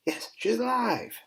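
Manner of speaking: high, loud, drawn-out exclamation; triumphant cry. Acted. Fan recapping the episode announces the revival, triumphant cry. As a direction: cry it out with slow, soaring triumph.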